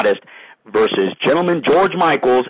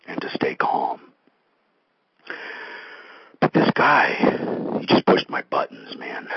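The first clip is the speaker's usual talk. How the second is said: whisper; 3 semitones higher, 3 dB quieter than usual. Acted. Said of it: "My New Year's resolution was not to get angry".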